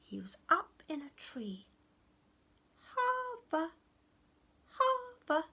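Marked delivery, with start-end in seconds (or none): 2.9-5.5 very high, sing-out goblin call; little goblin calling a name; tiny sweet goblin. Acted. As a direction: call down from a tree, high and drawn out. Tiny goblin calls from tree.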